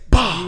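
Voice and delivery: loud sudden "bah" slam noise; imitated door slam. Comic imitating the mom slamming the door, explosive noise.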